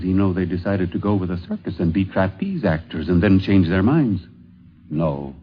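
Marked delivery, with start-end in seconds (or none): none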